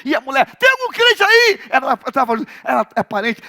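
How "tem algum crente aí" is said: high, loud call for a believer. Retold or imitated. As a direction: shout it to the whole crowd. Woman calling out. (grito chamando um crente)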